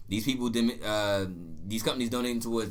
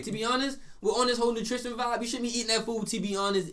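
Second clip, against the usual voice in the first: high-pitched; 11 semitones higher than usual. Pretend. High mock-preachy nutrition aside.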